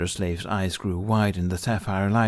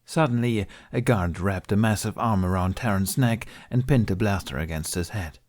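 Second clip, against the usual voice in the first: ordinary delivery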